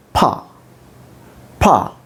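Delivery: ordinary talk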